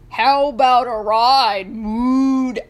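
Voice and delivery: loud, mooing cow voice; a cow mooing out a request; bossy cow. A narrator's reading. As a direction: draw the vowels into a moo. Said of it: "asked Bossy Cow".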